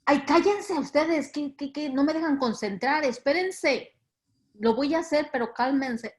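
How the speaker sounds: hammed-up impatient telling-off; mock exasperation. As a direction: play someone annoyed at being interrupted. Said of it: "No es que Jesús les, les dijo".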